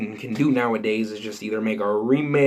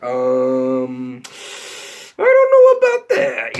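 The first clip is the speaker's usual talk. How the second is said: skeptical squeak; 5 dB louder, much slower than usual. Retold or imitated. Answering a friend who loves the Emoji Movie, skeptical squeak. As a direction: squeak your disbelief. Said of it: "so I'm like".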